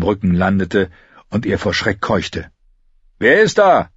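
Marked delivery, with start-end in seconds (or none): 3.2-3.9 loud, high sudden shout; sudden raised-voice roar; bellowed challenge. A narrator's reading. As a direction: call out a challenge into the dark. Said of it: "brüllte plötzlich eine Stimme".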